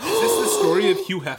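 high, fast, shocked question; surprised. Real, not acted. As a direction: blurt it out in disbelief. Sudden shock at the story.

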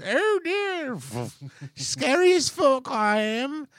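high-pitched, drawn-out old lady impression; mock old lady. Comic playing old lady as action star, high mock voice.

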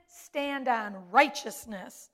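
ordinary talk, nothing marked

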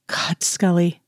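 breathless whisper of wonder; awed whisper. Acted. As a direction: whisper it, struck by her beauty. Awestruck at his lover's beauty.

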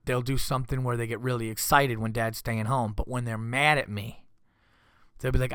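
normal talking voice

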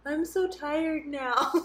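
slow, drawn-out mock weariness; whiny mock-exhausted complaint; tired. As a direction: imitate a worn-out friend, dragging the words. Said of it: "and you're like".

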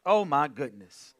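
usual speaking voice

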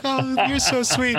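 high, drawn-out gushing woman; flattered woman. Retold or imitated. Woman thanking the man helping with her fish tank, imitated gushing. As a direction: coo it high and slow, mimicking her.

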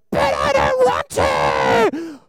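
put-on slow, low, drawn-out whine of a kid; whiny child. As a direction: drag it out like a sulky child refusing. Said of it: "your soul might go".

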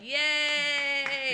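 high, loud mock cheer "Yay!". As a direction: cheer like the comics at the back. Comedians in back cheering.

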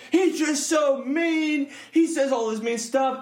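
high, slow whiny mimic of critics; mocking whiny critic. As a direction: drag it out like a sulky complainer. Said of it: "all these people who are so, like".

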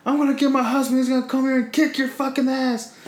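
high-pitched imitation of a threatening woman; retold angry threat. Lady threatens teenager with her husband, high mock-angry voice.

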